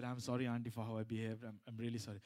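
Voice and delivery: low, subdued retold apology; sheepish apology. Son-in-law apologising to auntie for his behaviour, low and sheepish.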